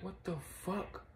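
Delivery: high, breathy mock shock; stunned, can't believe it; shocked disbelief. Destiny reacting in shock, voiced high. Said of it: "Destiny was like".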